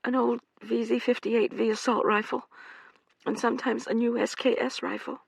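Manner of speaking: bitter. Acted. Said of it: "She said bitterly".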